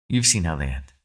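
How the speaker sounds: ordinary talk, nothing marked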